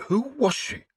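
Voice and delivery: the priest demanding the nun's name. A narrator's reading. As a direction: press for the name. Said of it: "he demanded".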